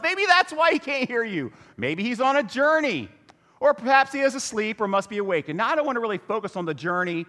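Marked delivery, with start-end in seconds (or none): 0.0-5.6 high, teasing taunt; mocking trash talk. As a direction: needle them with mock concern. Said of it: "Elijah mocked them".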